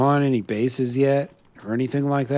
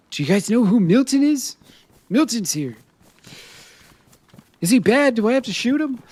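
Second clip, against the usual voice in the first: imitating another player; 9 semitones higher than usual. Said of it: "He's probably checking in with".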